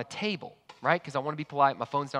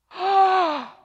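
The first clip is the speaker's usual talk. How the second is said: big high shocked gasp; a sharp, high gasp of shock at the news; dramatic gasp; 8 dB louder than usual. Acted. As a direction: gasp loudly in horror. Hears kids locked in car.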